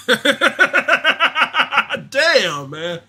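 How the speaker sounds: rueful laugh. Real, not acted. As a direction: laugh at your own missed bet. Laughing at missed parlay.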